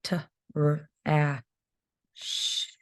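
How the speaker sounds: "Trash" then split into "t", "r", "ash", each sound said apart; teacher sounding out a word for first graders. Shown as a demonstration. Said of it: "that digraph at the end".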